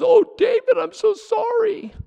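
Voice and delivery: high, hushed, whimpering fake sorry; a wildly overdone false-tearful plea; mock weeping apology. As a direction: sob out a hammed-up apology to David. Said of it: "He's weeping and crying".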